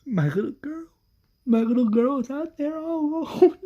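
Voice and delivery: weeping mother, high and breaking; crying. Acted. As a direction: sob it out as a distraught mother. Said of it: "Carol starts crying and says".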